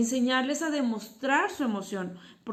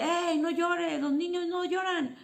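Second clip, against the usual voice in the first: higher, mock stern parent telling a child off; imitating a scolding parent; 7 semitones higher than usual. Parent scolding a crying boy, mock stern and higher. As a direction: play the dismissive parent, lightly. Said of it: "ahí es donde entra mamá, papá, que dice".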